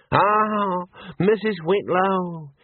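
high, drawn-out greeting; lingering, high-pitched salutation; greeting call. A narrator's reading. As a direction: hail her in a slow, high voice. Senior wrangler greets Missus Whitlow.

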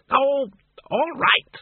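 very high, grudging squeak; squeaky giving-in. A narrator's reading. Arthur the bat yielding to Doreen, squeaked. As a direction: give in with a squeaky huff.

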